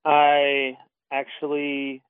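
very slow, drawn-out stalling. Real, not acted. Guest stalls while looking up the author for listeners, slow and drawn out. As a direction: stretch each word to buy time. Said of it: "I'm going to talk a little bit slower".

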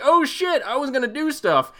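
sudden high panicked realisation; startled drunk wife. Retold or imitated. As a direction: jolt awake, high-pitched and flustered. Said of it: "she wakes up ... like".

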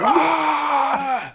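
high, loud mock victory roar; imitated roar. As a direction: roar back in mock triumph, then ease off. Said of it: "he just starts fucking roaring back at it".

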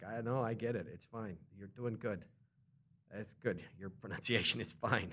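soft, low, patient reassurance; gently encourage, keeping it quiet and low; kind reassurance. Retold or imitated. Reassuring someone struggling to learn English, soft and patient. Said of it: "you become very nice about it".

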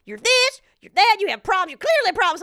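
mock-scolding like a cold therapist; hammed-up lecture from a blunt therapist; harsh therapist. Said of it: "harsh and judgmental".

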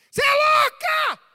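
high, very loud outburst, drawn out; acted furious yell. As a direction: explode at her, stretching the words.

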